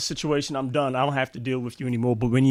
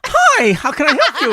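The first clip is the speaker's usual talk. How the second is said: higher, louder professional phone voice, laughing; 13 semitones higher, 9 dB louder than usual. Retold or imitated. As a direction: laugh through an imitated customer-service greeting.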